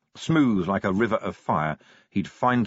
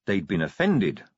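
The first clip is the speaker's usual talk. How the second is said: ordinary delivery, nothing marked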